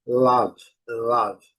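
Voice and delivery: love said lav, short open vowel between O and A; love with a short vowel mixing O and A; English love. Shown as a demonstration. Teacher modelling love as lav for Brazilian students. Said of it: "de mistura de A com U".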